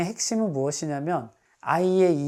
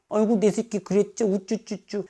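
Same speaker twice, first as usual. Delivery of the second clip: put-on higher cooing baby talk; 6 semitones higher than usual. Shown as a demonstration. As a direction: coo at the child like a baby. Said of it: "너무 애기 취급하면서". (아기 취급하는 우쭈쭈 말투)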